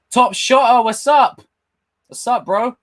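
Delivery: high, loud shout-out to a fan; loud hype greeting. Real, not acted. Streamer hyping up a chat donor, loud and high. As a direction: call out the name with big energy.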